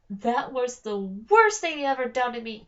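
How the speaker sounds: loud wounded whining; betrayed whine. A narrator's reading. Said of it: "being completely betrayed".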